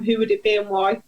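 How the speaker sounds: normal talking voice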